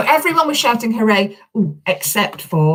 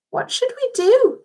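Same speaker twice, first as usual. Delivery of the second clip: worried question. Acted. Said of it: "asked Alfie".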